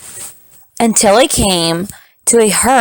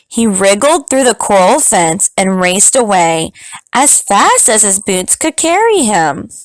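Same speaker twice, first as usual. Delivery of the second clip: ordinary delivery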